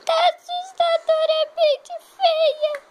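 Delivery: high, loud, slow exaggerated dread; mock horror. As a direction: stretch it out like a spooky taunt. Calling the girl horribly ugly. (zombaria aguda, lenta e exagerada)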